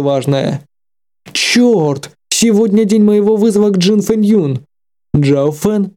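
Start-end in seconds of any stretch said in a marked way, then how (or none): 1.3-4.6 sudden panicked remembering; alarmed exclamation. Acted. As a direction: blurt it out in alarm.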